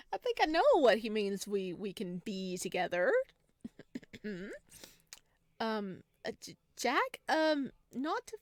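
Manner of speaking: put-on high, hesitant girlish voice; shy heroine. Heroine awkwardly telling Jack what she feels, high and hesitant. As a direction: stammer it out, embarrassed and halting.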